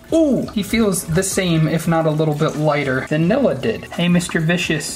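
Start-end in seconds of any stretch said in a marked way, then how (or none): 0.1-0.5 surprised yelp. Real, not acted. Turtle bites finger.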